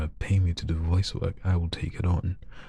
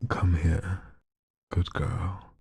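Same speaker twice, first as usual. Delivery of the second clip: a hint of a purr, drawn out; sexy voice; much slower than usual. Streamer reads a viewer's request in a mildly sexy voice. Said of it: "in a sexy voice".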